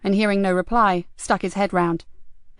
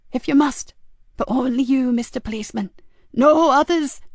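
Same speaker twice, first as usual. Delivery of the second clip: higher voice, reluctant then insistent; wary woman caller; 5 semitones higher than usual. A narrator's reading. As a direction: give in, then warn firmly. Frankie reluctantly agrees.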